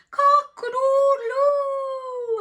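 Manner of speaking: long, slow, high crowing call; drawn-out cock-a-doodle; rooster crow. Acted.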